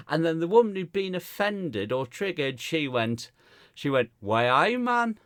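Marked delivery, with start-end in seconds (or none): 4.2-5.2 Geordie catchphrase, rising at the end; lightly put-on Newcastle accent; mock Geordie accent. Comic imitating Newcastle talk to audience, mock Geordie. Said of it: "from Newcastle".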